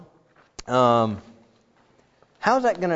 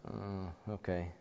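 reluctant okay; 6 semitones lower, 18 dB quieter, slower than usual. Retold or imitated. Daughter's reluctant answer.

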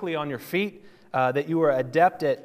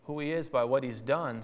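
ordinary talk

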